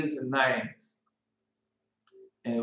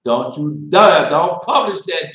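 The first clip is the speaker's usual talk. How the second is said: loud firm scold, slow on dare; 10 dB louder than usual. Real, not acted. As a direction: tell him off sharply. Scolding someone about publishing.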